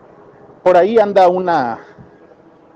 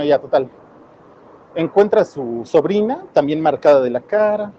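ordinary talk

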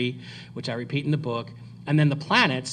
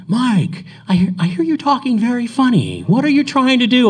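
baffled retort; 10 semitones higher, 9 dB louder than usual. Retold or imitated. David's baffled reply, retold.

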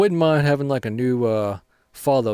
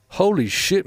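startled exclamation; 8 semitones higher, 3 dB louder than usual. Real, not acted. Sudden surprise during gameplay.

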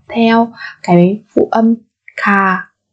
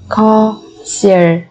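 slow, separated syllables for learners; careful teacher's slow model; slower than usual. Shown as a demonstration. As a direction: spell it out slowly, beat by beat.

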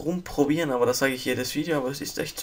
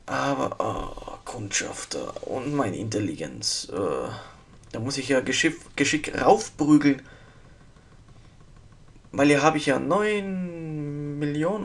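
ordinary delivery

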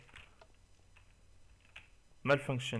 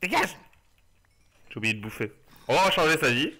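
ordinary talk

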